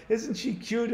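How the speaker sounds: put-on high, cooing baby talk; doting parent. Parents laughing at their child's tantrum, high cooing. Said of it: "and they go".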